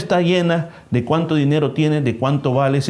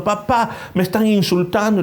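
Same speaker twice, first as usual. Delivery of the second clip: put-on scared child; 6 semitones higher than usual. Child reports insults to father.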